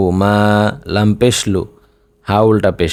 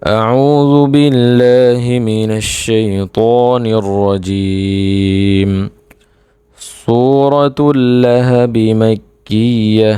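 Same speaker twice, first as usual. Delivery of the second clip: slow, drawn-out tajweed recitation; Quran recitation; much slower than usual. Acted. Teacher opens a Quran lesson with the opening invocation, slow recitation. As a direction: recite with long, sustained vowels in measured phrases.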